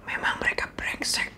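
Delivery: tempting devil whispering a grudge; devil's whisper. Retold or imitated. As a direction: hiss it like the devil in your ear. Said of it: "suara iblis ngomong gini".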